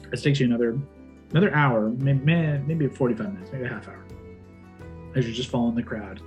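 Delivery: ordinary delivery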